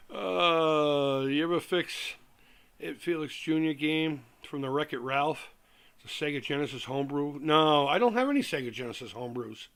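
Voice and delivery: usual speaking voice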